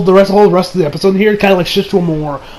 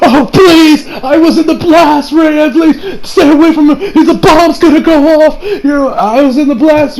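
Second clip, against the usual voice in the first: panicked pleading; 8 semitones higher, 4 dB louder than usual. Retold or imitated. Kira fakes a hurt bystander.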